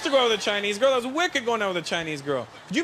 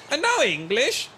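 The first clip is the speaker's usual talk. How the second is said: rising, disbelieving question; mock disbelief at the caller; incredulous dad; 6 semitones higher, slower than usual. Dad realizes no English.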